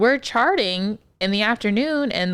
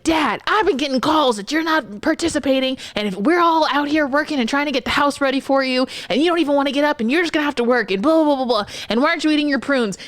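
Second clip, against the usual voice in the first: scolding son; 6 semitones higher, faster than usual. Retold or imitated. Son barging in to scold his father, mimicked higher. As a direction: lecture dad like the son. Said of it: "he's like".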